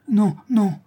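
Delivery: high, loud, hasty protest; young girl insisting she is fine; reassuring denial. A narrator's reading. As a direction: brush the worry away quickly. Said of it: "dit la jeune fille pour rassurer sa mère".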